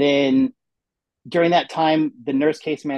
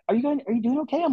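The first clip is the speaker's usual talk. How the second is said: high sweet nurse voice; 10 semitones higher, faster than usual. Retold or imitated. Nurse checks in.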